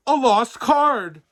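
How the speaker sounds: loud, high and slow; slow, loud alarmed shout. A narrator's reading. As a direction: cry out the bad news. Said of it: "roared the postmaster".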